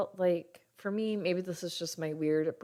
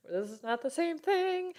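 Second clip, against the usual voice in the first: incredulous laugh; 6 semitones higher than usual. Retold or imitated. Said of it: "You're like".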